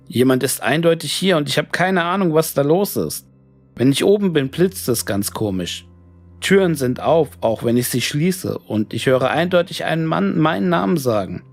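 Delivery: plain reading voice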